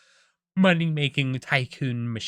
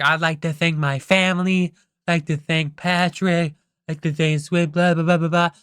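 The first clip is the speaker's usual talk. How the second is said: raised, loud cartoon acceptance speech; SpongeBob; 4 semitones higher, 5 dB louder than usual. Winner thanking family and friends, raised mock speech voice. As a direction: proclaim your thanks, ending in loud blahs. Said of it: "he starts his, like, acceptance speech".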